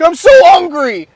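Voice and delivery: high, loud whiny mock complaint; mock whine. Mocking how people complain, high loud whiny kid voice. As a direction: overact the hunger like a whiny kid.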